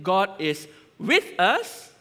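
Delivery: "with" lifted high in a slow rising line; slow down and lean on "with"; stress on WITH. Shown as a demonstration. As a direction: make "with" the heart of the phrase. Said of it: "or is it".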